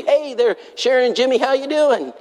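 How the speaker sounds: high, bright hello across the room; cheery greeting. Retold or imitated. Sing out a friendly hi. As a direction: church members welcoming the couple in town, with a high cheery call. Members greeting visitors warmly.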